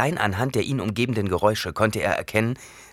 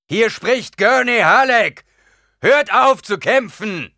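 slow, much higher, louder calling; shouting through cupped hands; 10 dB louder, slower than usual. Acted. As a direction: call out over the fight, slowly. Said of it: "legte die Handflächen trichterförmig an den Mund". (langsam und laut gerufen)

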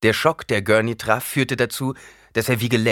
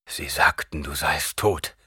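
lower, half-whispered repetition; quiet repeat; 3 semitones lower, slower than usual. Acted. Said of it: "wiederholte Gurney". (leise wiederholt)

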